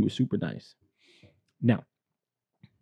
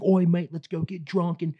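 much higher, loud mock British lad; British accent; 9 semitones higher, 3 dB louder than usual. Imitating a British friend's party talk, in a high hammed-up British accent. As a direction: ham up a rugby-bro British accent. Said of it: "So he's like".

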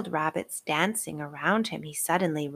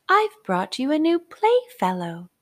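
high, louder fairy voice; bright fairy announcing a newcomer; tiny tinkling fairy voice; 8 semitones higher, 6 dB louder than usual. Acted. Fairy presents the rabbit.

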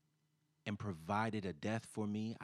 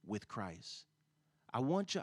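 ordinary talk

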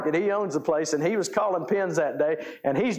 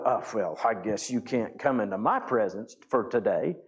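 mock dismissive God; 7 semitones lower than usual. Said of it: "God's not going".

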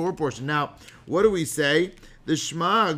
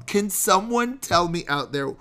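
ordinary talk, nothing marked

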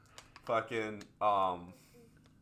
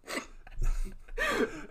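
sharp high laugh burst. Real, not acted. Laughing at group chat story.

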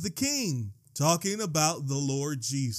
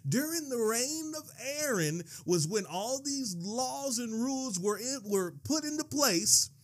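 pitched high in preacherly relish; gleeful preaching; 6 semitones higher than usual. Real, not acted. Preacher teasing a point that upsets people, gleefully excited.

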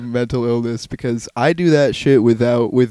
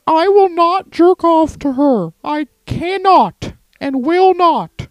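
slow, high mock-defiant caricature; high voice declaring a solemn refusal; Jordan Peterson; slower than usual. Host mimics a man taking a stand, high and defiant caricature. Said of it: "He's taking a stand against her".

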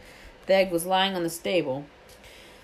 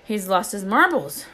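ordinary delivery, nothing marked

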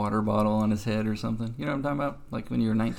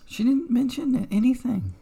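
high mock; 13 semitones higher than usual. Mocking the doctor's silence.

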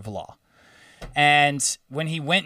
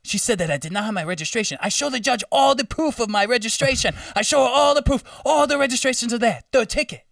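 high, animated African driver impression; imitated African accent, pitched high and emphatic; 10 semitones higher than usual. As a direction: make the triumphant case in a put-on African accent. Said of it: "I'm gonna do the accent".

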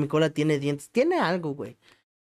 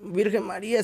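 mock startled gasp; 7 semitones higher than usual. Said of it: "le hice".

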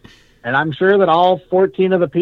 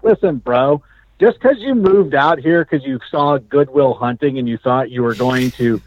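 ordinary delivery

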